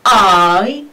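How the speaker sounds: teacher's long model I; diphthong demo. Shown as a demonstration. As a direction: slide I from ah into ee. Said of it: "وهنا تنطق على شكل".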